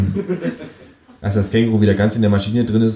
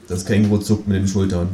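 usual speaking voice

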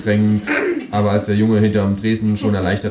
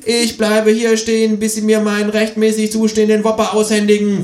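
defiant kangaroo; 13 semitones higher, 4 dB louder than usual. A narrator's reading. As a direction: refuse to budge in a high voice. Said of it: "sagt das Känguru".

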